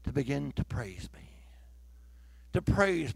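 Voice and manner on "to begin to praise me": God's voice whispered. Retold or imitated. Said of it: "He said".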